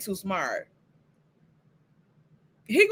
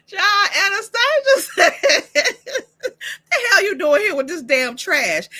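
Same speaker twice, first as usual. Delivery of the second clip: laughing while telling; 7 semitones higher, 4 dB louder than usual. Real, not acted. Cracking up while retelling.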